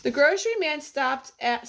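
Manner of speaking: usual speaking voice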